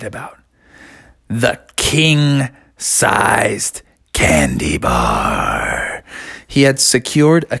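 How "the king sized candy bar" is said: much lower and very slow; storyteller drops low to savour the name; dramatic reveal. A narrator's reading. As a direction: unveil the treasure in an awed deep voice.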